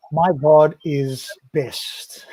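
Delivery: very slow, each word spaced out; slow title read. Shown as a demonstration. Slowly announcing the verse title to his co-host. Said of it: "seventy-one".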